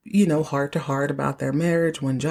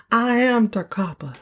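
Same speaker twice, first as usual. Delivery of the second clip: higher, unbroken "I am Takapa"; declaring; 5 semitones higher than usual. Retold or imitated. Host redoing Jock's line after stumbling, declaring it. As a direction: announce "I am Takapa" in one go.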